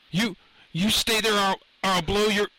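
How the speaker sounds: high, loud, stammered threat; panicked threat. A narrator's reading. Lenny aiming a gun at the professor, loud panicked stammer. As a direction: threaten loudly and lose your nerve mid-sentence.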